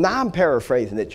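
normal talking voice

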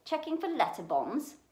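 high, teasing shout; jokey taunt. A narrator's reading. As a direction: call out a joke at the boy's expense. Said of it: "He chuckled at his own joke".

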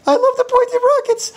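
loud, fast falsetto squeal; manic adoration. Acted. Faux tycoon obsessed with pointy rockets, manic adoration. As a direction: shriek it in giddy falsetto.

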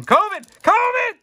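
high yelped joke cry; mock alarm. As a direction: yelp it in fake panic. Joking about runny nose.

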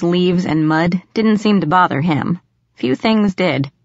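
plain reading voice

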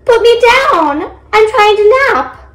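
high, slow little creature voice; small high voice, drawing the words out. A narrator's reading. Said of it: "heard a little voice".

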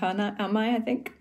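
usual speaking voice

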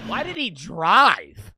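slow, loud, incredulous; baffled loud question. Real, not acted. Reacting to the suspect who drove high, loud and slow. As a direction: drag it out in disbelief.

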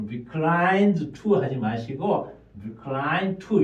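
reclined to said as recline to; dropped final d. Shown as a demonstration. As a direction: merge the d into the t of to. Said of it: "끝자음을 한 개 안 씁니다". (reclined to를 recline to처럼 이어서)

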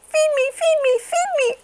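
put-on very high baby whine; very high hungry baby duck. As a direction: beg for food as a tiny duck. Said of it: "cried the hungry baby duck".

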